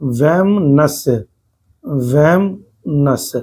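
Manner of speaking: वैमनस्य drawn out very slowly, twice; teacher dictates the right spelling; very slow repetition. Shown as a demonstration. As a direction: stretch वैमनस्य out syllable by syllable. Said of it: "इसको ऐसे लिखते हैं".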